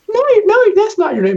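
high, loud disbelieving yell; incredulous shout. Retold or imitated. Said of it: "And they go".

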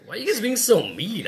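high, pleading whine; whiny mock plea. [name] asking kids why they are mean, high whine. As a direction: plead with hurt feelings. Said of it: "I'm like".

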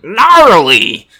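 loud high overdubbed yell; dubbed gnarly. Retold or imitated. Mimicking a movie's dubbed audio, loud high yell. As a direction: blurt it like a cheesy overdub. Dubbed surfer exclamation in clip.

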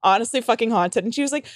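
ordinary delivery

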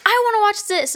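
high-pitched imitation of a bratty child; demanding kid. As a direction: mimic a kid insisting on her way. Acting a demanding little kid.

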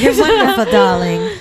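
high, rising posh British voice; posh British darling. Pretend. Friend tossing a compliment in mock posh British. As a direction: gush it in a posh British accent.

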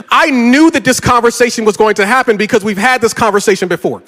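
normal talking voice

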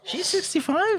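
shocked high question; disbelieving rise; surprised. Real, not acted. Shocked at age.

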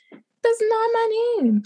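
wyższa, wolniejsza poprawka nauczycielki; powtórz wolniej i wyżej, z naciskiem. Retold or imitated. Repeats the correction, higher, slower.

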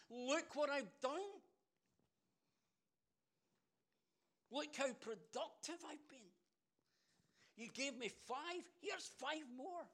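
high, loud, slow exultant boast; thrilled servant who can't wait to tell; bubbling enthusiasm. Acted. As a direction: crow it out, stretching the words. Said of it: "bubbling over with enthusiasm".

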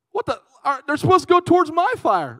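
high, loud mock outrage; indignant disbelief. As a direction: protest as a baffled homeowner. Homeowner protesting at firefighters running away.

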